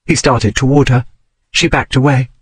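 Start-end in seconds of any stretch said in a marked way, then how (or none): none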